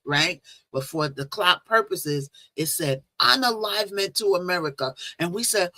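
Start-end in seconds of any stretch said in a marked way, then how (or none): none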